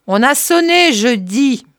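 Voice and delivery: high, loud, impatient repeat; irritated shout. Acted. Curé to his servant, who didn't hear, loud and impatient. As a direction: snap it back at her across the house.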